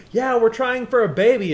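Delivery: sing-song sendup of proud parents-to-be; mock couple announcement. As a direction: parody a cheerful couple announcing it. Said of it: "so socially acceptable it is to say".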